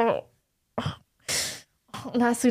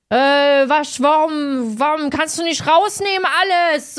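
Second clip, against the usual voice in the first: mock rude security worker, a bit louder and higher; rude snapping; 5 semitones higher, 5 dB louder, slower than usual. Security worker scolding the traveler about his suitcase, mock rude and louder. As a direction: snap impatiently at the traveler like a rude official. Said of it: "assi einfach".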